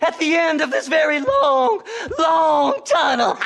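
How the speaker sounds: overjoyed treasure hunter; gleeful gloating. Acted. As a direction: crow over your luck. Seth gloating over treasure.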